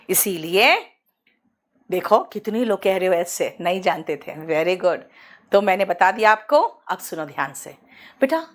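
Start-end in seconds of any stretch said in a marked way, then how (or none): none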